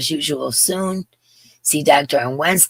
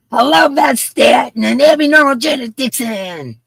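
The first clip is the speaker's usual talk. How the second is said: high, loud roll call; calling out names; 9 semitones higher, 7 dB louder than usual. Real, not acted. Host greets viewers by name, calling out high and loud. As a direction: holler the hello across the crowd.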